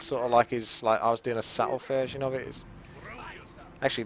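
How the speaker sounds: ordinary talk, nothing marked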